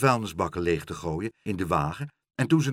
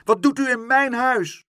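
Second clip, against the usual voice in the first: loud, high-pitched challenge; indignant shout; 8 dB louder than usual. A narrator's reading. Pluk confronts the intruder in his home, indignant shout. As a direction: demand it at the top of your voice. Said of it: "riep Pluk".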